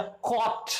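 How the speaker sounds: कॉट said with a long open o. Shown as a demonstration. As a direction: hold the big o in कॉट. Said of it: "बड़े ओ की".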